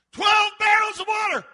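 high, full-force call; shouted order. Acted. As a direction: bellow the demand across a crowd. Acts Elijah calling out orders.

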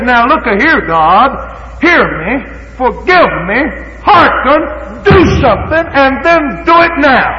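high, loud, urgent demands; forceful pleading prayer. Retold or imitated. Preacher voicing Daniel demanding God act now. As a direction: pound out each plea. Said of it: "Daniel pinpointed and said".

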